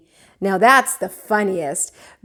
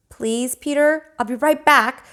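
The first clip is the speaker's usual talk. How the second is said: ordinary delivery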